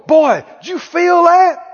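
high, loud, slow challenge; imitated preacher's insistent shout; Brother Hagin hollering. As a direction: holler it with drawn-out words. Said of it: "him hollering".

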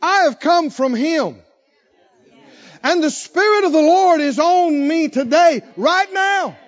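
high, loud, bold proclamation; Jesus. Acted. Preacher quotes Jesus against the droning scribes, loud and bold. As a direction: declare it with full preaching authority. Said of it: "Jesus said".

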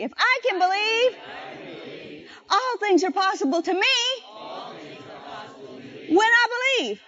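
preacher's shouted refrain; rousing call. Shown as a demonstration. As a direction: lift it up and shout it out. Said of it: "say it with me".

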